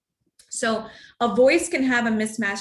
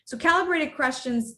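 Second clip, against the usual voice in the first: normal talking voice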